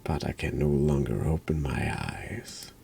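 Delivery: soft, near-whispered reading of game lines; hushed reading. Acted. Player reading the game's text in a hushed whisper. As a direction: breathe the words out quietly, slowed.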